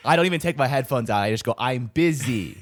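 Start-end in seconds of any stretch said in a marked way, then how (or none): 1.6-2.6 slow, drawn-out brush-off; mock brush-off. To street canvassers, drawn-out mock brush-off. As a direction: stretch out each word to dismiss them.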